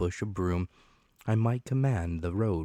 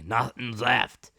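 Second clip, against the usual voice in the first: pirate report; 5 semitones higher, 5 dB louder, slower than usual. Acted.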